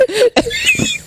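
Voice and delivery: very high, half-crying laugh; high wailing laugh. Real, not acted. Laughing at her own crying at work, high wailing laugh.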